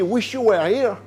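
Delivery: proudly homemade English accent; French speaker's go at English. Real, not acted. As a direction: give the title an English accent. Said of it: "l'accent anglais de [name]".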